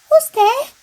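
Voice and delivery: high, loud startled squeak; frightened cry. Acted. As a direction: mouse jumps at the glowing eyes. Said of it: "Mouse cries".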